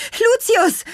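very high, loud call. A narrator's reading. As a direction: call sharply for a friend by name. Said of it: "rief sie".

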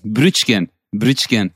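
Brötchen said as brʏtʃkən, ü for ö and tsch; Brötchen with Turkish sounds brʏtʃkən; imitating mom at the bakery; Turkish mother's pronunciation. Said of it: "sagt sie".

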